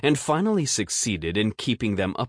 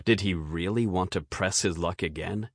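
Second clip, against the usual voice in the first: plain reading voice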